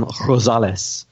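throaty, rasped R at the start of Rosales; attempted Spanish R. Real, not acted. Host reads a listener's name, attempted Spanish R. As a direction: say Rosales slowly with a Spanish-style guttural R. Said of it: "classic Spanish rolling R".

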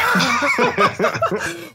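a high burst of real laughter. Real, not acted. Laughing at the friend's story, a high burst of laughter.

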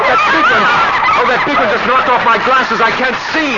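very high, loud and strained; panicked shouting. Acted. Husband crying out as a bird knocks off his glasses, panicked. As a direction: cry out in panic, blinded.

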